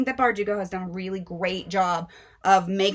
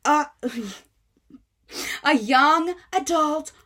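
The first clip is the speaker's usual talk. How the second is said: mocking irate mom; 7 semitones higher than usual. Mother scolding bookseller for selling her daughter the book, high and mocking. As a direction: ham up the furious mom, slowing at the end. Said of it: "And she's like".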